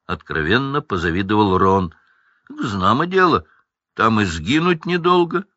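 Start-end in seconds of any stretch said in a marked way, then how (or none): 2.5-5.4 higher, mildly rasped character voice; hoarse. A narrator's reading. Hagrid warns of the alley, a touch hoarse. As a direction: rasp it lightly, pitched up. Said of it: "прохрипел Хагрид".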